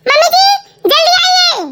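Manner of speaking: daughter-in-law shrieks for mother-in-law to come; urgent scream. Acted. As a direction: cry out for help in alarm. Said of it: "ऐसे क्यों चीख रही है".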